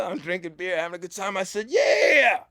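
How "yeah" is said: big excited shout of yeah, higher and louder; excited yell. Retold or imitated. Storyteller shouting yes to his dad's band, excited yell. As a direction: shout it like you can't wait to get up there.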